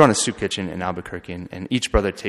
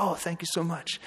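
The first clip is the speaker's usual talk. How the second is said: higher, softer, grateful little voice; grateful woman; 9 semitones higher, 6 dB quieter than usual. Retold or imitated. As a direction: voice the woman's soft thanks, pitched up.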